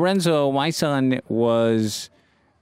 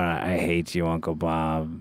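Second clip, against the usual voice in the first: underwater mutter; 8 semitones lower, 6 dB quieter, slower than usual. Retold or imitated.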